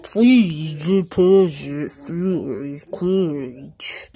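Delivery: very slow, loud warped robot; slowed-down machine voice. Acted. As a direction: drag every word out like a broken machine. Machine prompt replayed distorted.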